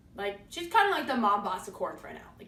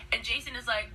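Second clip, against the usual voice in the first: ordinary talk, nothing marked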